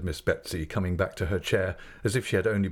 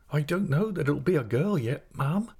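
higher-pitched, naive reply; innocent; 7 semitones higher than usual. Acted. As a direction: answer with innocent puzzlement, lifting at the end. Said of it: "said my mother innocently".

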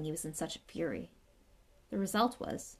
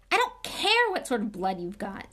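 louder, fierce retort; 3 semitones higher, 7 dB louder than usual. A narrator's reading. Harry snapping back at Ernie about blood, fiercely. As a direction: bite back hard at him. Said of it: "said Harry fiercely".

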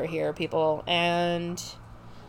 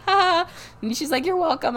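much higher, smug mock-proud mom; 8 semitones higher than usual. Mom proudly taking credit, smug and high.

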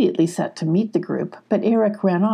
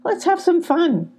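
much higher badger voice, protesting; arguing badger; 11 semitones higher than usual. A narrator's reading. The Badger arguing with Gruff, much higher. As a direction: push back, pitched up.

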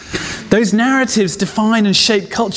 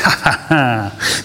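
mocking laugh; 6 semitones lower, much slower than usual. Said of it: "mocking exercise".

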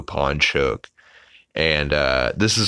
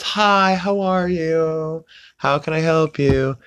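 very high-pitched, perky sales greeting; upbeat sales lady; 11 semitones higher, slower than usual. Retold or imitated. Sales lady greeting customers in a furniture store, upbeat high voice. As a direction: put on a high, drawn-out cheery saleswoman voice. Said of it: "there's an upbeat sales lady".

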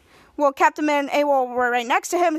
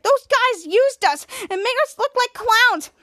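high, slow, outraged; indignant complaint; 8 semitones higher, slower than usual. Acted. Shadow complaining the villains made them look like clowns, indignant. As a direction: spit out the insult slowly.

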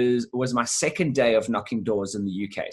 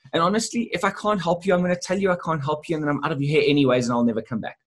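fast pitch; 4 semitones higher, faster than usual. Retold or imitated. Salesman's pitch to the woman.

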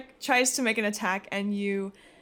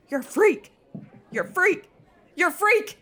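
each freak higher and louder; rising frightened yell; 9 semitones higher, 5 dB louder than usual. Acted. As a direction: back away shouting in growing panic. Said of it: "And he goes".

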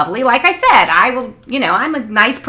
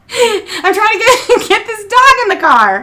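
laughing; 13 semitones higher, 4 dB louder than usual. Real, not acted. Teller cracking up at her own story, laughing through it.

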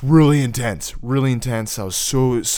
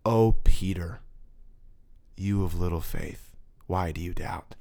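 hushed, tender whisper, lower; soft whispered voice; 6 semitones lower, 3 dB quieter than usual. Retold or imitated. Recalling God's voice whispering in his ear, soft tender whisper. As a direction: breathe the words gently into an ear. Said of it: "This was the tone".

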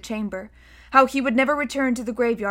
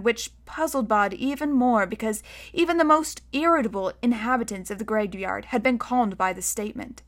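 plain reading voice

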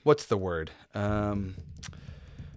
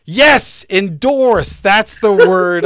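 excited; 3 semitones higher, 7 dB louder, much slower than usual. Real, not acted. Finally recalls the missing word.

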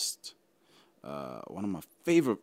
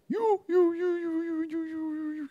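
much higher, cracking, near tears. Real, not acted. Podcaster telling listeners about apartheid scars, voice cracking high. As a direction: choke on each word, close to crying.

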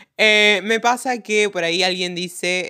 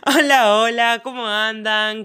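loud bright greeting, pitched up; copied greeting; 3 semitones higher, 3 dB louder, slower than usual. Retold or imitated. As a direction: imitate another host's hello. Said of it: "me copié el saludo".

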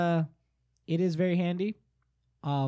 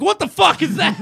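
loud, high, laughing outburst; startled shout; 13 semitones higher, 13 dB louder than usual. Retold or imitated. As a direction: yell it half awake and shocked. Woken by loud speaker.

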